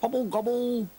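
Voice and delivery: high gobbling call; turkey gobble. Pretend. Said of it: "said the dog".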